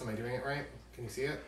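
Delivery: usual speaking voice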